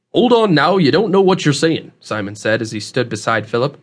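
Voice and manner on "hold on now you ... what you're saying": higher, louder country-dialect warning; rustic old man, cautioning. Acted. Host stops young man heading back, cautioning rustic voice. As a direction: rein him in with a folksy, emphatic twang.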